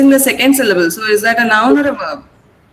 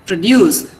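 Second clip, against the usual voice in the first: produce as pruh-DUCE; second-syllable stress; 5 semitones lower than usual. Shown as a demonstration. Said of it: "Second syllable is stressed for verbs".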